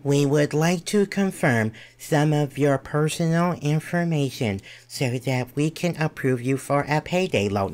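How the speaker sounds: imitated robotic voicemail script; stilted mock robocall voice; robotic automated message. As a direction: recite it like an automated phone recording. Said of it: "that B S robotic script".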